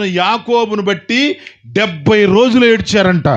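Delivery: high, ringing preacher's call; preaching at full force. Real, not acted. Preacher urging the church to hold on through suffering, ringing and fervent. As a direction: lift the congregation with rising fervour.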